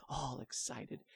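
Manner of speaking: plain reading voice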